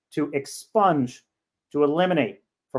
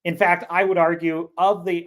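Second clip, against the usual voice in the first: ordinary delivery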